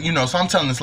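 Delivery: ordinary delivery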